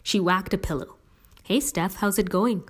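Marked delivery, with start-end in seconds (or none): none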